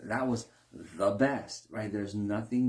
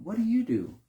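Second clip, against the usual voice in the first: eager question; 13 semitones higher, 6 dB louder than usual. Retold or imitated. Eagerly asking advice.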